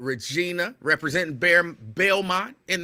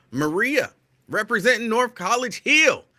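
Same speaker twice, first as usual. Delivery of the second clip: raised, higher call-out of a viewer's hometown; excited yelling; 7 semitones higher, 3 dB louder than usual. Real, not acted. Host greeting viewer Maria, excitedly calling out her neighborhood. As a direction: gets carried away greeting a viewer. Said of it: "I don't know why I'm yelling".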